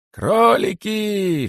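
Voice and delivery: high, long-drawn shout; distant calling. A narrator's reading. Cheeky rabbit still calls from the jungle, long shout. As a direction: stretch the call out high, as if carrying far. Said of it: "всё ещё доносился голос дерзкого кролика".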